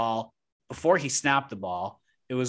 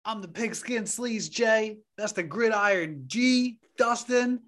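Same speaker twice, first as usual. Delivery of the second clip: announcer voice; 8 semitones higher than usual. Acted. Introducing hosts in announcer voice.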